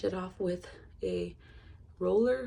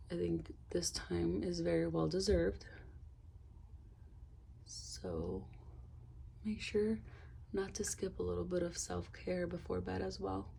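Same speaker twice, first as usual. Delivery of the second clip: whispering; 6 dB quieter than usual. Acted. Soft whispered self-care advice.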